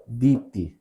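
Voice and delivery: दीप्ति with short final इ; correct pronunciation. Shown as a demonstration. Teacher giving the correct word, pronunciation demo. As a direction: finish दीप्ति on a brief i. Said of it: "यहां पर सही है".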